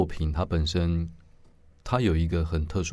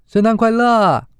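much higher, loud bright greeting; cheerful woman's greeting; 12 dB louder than usual. Acted. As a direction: sing out a festive hello. Sisters enter greeting. (高音欢快的圣诞问候)

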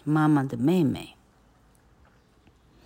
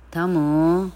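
calling out; 3 semitones higher, 7 dB louder than usual. Acted. Aunt calling for Tom.

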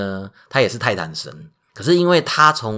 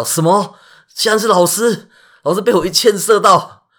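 much higher, loud horrified cry; shocked panic; 10 semitones higher, 6 dB louder than usual. Acted. Hercules realizing he shot his teacher, horrified panic. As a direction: gasp it out in horror.

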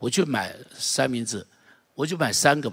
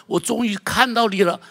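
excited cry; 8 semitones higher, 4 dB louder than usual. Retold or imitated. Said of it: "哭着笑着说".

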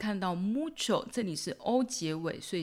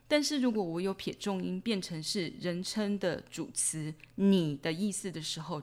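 ordinary talk

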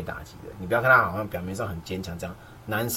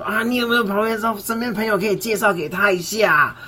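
mother asking; 11 semitones higher, 6 dB louder than usual. Retold or imitated. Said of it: "他妈妈跟我说：".

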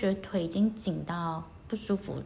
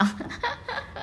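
a light airy giggle; breathy laugh. Real, not acted. Host giggling about getting sleepy.

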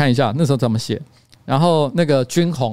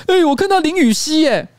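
excited exclaim; 14 semitones higher, 4 dB louder than usual. Retold or imitated. Assistant excitedly reports in group chat.

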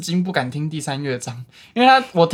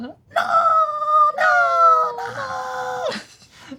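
mock shriek of no; much slower than usual. Performing the scream in the piece.

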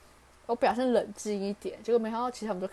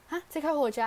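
pitched up, startled question; surprised; 7 semitones higher, 3 dB louder, faster than usual. Retold or imitated. Replaying surprise at supervisor telling her to drive straight home, higher startled question. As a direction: echo his words back in disbelief, higher.

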